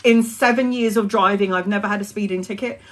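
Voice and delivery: ordinary delivery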